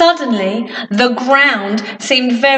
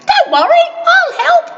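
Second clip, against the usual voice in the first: very high tiny voice; Mister Small; faster than usual. Acted. Mister Small offers help, very high tiny voice. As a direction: squeak it like a tiny man. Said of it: "said Mister Small".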